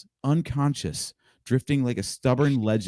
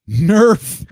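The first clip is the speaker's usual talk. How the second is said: punchy shout; 8 semitones higher, 10 dB louder than usual. Acted. Reading knuckle text.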